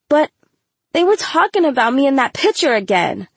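high, pleading student voice; defensive protest. A narrator's reading. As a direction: plead your case to the teacher. Student defends herself to teacher.

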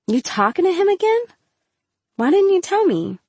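high, rising incredulous questions; indignant disbelief. A narrator's reading. Girl learning her friend hid talking to him, indignant. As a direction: demand answers from a friend.

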